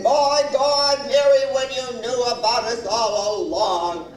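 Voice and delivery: loud, angry husband imitation; mimicked husband shouting his grievance; terribly mad. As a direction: play his furious, wounded outburst. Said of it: "that's when he got terrible mad".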